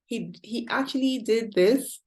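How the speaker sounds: ordinary delivery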